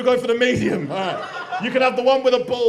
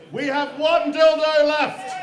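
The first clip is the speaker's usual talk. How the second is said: slow, loud, raised announcement; MC's drawn-out call; announcing; 4 semitones higher, 5 dB louder, much slower than usual. Real, not acted. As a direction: draw it out loudly for the whole room. Host announces to the crowd.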